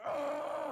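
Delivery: high, loud pained scream; retold scream of pain. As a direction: scream as the needle goes in. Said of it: "Just, just screaming at him".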